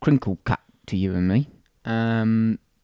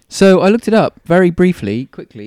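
ordinary talk, nothing marked

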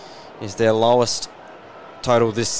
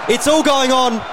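erupting over a goal; long goal yell; 11 semitones higher than usual. Real, not acted. Goal kicked, commentator erupts.

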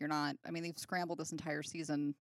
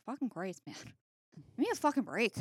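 exasperated; 7 semitones higher, 4 dB louder than usual. Retold or imitated. Buffy fed up with everyone.

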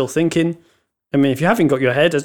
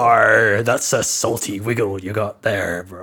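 pirate voice; 5 semitones lower than usual. Acted. Pirate voice joke again.

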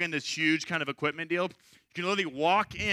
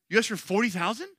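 higher, rising disbelief; incredulous; 5 semitones higher than usual. Retold or imitated. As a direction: question it as if stunned. Retold stunned reaction to huge request.

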